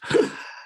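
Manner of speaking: long unvoiced exhale, demonstrating; slow breath out. Shown as a demonstration. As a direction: breathe out slowly to show how. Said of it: "empty your lungs out slowly".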